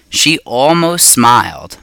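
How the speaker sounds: usual speaking voice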